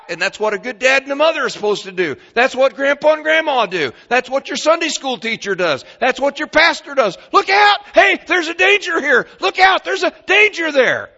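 high, loud, fast preacher's shout; preaching at full force. Acted. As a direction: warn the whole church like a lookout. Pastor preaching warnings at full force.

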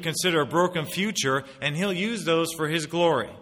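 loud, high fervent preaching; rising passionate sermon voice; preaching at full force. Acted. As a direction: drive it home to the congregation.

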